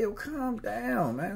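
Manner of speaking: high, slow mock plea to calm down; imitated slow, high-pitched calming; calming someone down. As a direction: draw it out high to talk someone down. Talking down someone zapping out.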